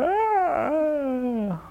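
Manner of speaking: long, high drawn-out wail; mock scream. Preacher mimics the fright the enemy causes, mock scream. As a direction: stretch out a pretend cry of fright.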